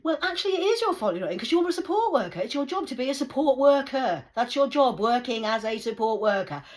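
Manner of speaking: high, loud ranting tirade; angry shout. Retold or imitated. Shouting at the support worker, angry. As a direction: hammer every word in anger.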